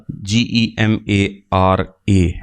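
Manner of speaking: slow, low letter-by-letter spelling. Shown as a demonstration. Teacher spelling a new term for students, spelling out slowly.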